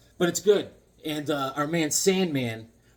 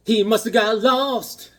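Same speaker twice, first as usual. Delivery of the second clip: dramatic; 8 semitones higher, 8 dB louder, slower than usual. Acted. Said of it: "repeats title dramatically".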